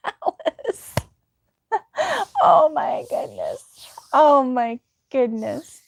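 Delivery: breathless laughing, words drawn out slowly; real helpless laughter. Real, not acted. Streamer cracks up at viewer's name mishap, laughing. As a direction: struggle to speak through laughter.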